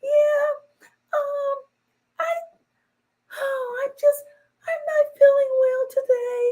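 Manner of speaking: put-on very high whimpering baby voice; mock her fragile act; fake sweet voice. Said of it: "talk like you supposed to".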